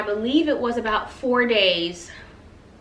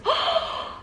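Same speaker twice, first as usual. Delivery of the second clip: excited gasp. Real, not acted. Excited over the rug.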